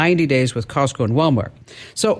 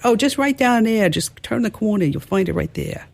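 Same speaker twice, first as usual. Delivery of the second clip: imitated New York accent, starting high; high-pitched mock New York accent, dropped r's; 6 semitones higher than usual. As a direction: give quick directions like a New Yorker. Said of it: "she had a New York accent".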